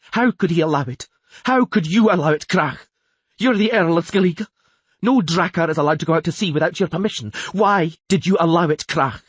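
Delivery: loud, high, furious queenly tirade; shouted. A narrator's reading. Queen berating the Earl for allowing the voyage, shouted. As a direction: berate him at full voice, pitched high. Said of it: "shouted the queen".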